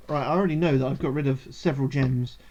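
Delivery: ordinary talk, nothing marked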